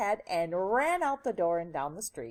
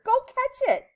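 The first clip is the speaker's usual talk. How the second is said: high, loud urgent order; high-pitched motherly command; 8 dB louder than usual. A narrator's reading. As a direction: call it out in alarm.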